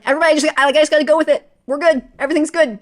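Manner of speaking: loud, high reassuring to everyone; loud reassurance. Retold or imitated. Crying in an awkward moment, loudly reassuring everyone it's fine. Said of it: "but I was like".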